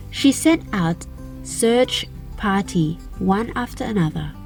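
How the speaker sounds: plain reading voice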